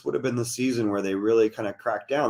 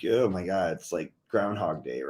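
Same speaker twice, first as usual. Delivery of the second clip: lightly voiced weary player, low and soft; imitating a fed-up sigh of a remark; mock weariness; 7 semitones lower, 4 dB quieter than usual. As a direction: drop low, like a player tired of the repetition. Voicing worn-out players' feelings.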